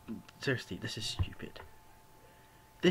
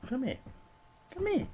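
much higher, sing-song call to the cat; coaxing cat call. Real, not acted. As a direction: call the cat up high and sweet. Calling the cat.

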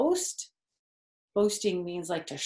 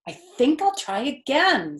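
higher, louder plucky pig; determined pig; 7 semitones higher, 7 dB louder than usual. Acted. Wilbur deciding to try again, higher and louder. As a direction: say it bravely. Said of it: "said Wilbur".

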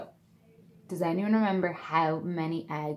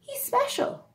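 high, tender mother duck voice; protective Mummy Duck. A narrator's reading. Mother duck defending her duckling, high and tender. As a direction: shield her odd baby, lifting the voice high. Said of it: "said Mummy Duck protectively".

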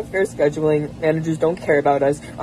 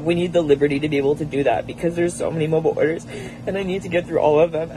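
usual speaking voice

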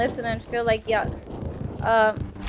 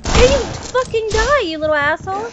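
furious yell; 7 semitones higher, 4 dB louder than usual. Real, not acted. Gamer lunging at an enemy player, furious yell. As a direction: roar it at the enemy.

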